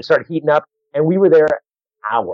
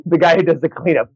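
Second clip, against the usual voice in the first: ordinary talk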